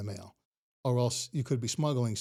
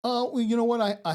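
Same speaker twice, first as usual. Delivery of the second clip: excited; 11 semitones higher, 6 dB louder than usual. Real, not acted. Excited start to plug.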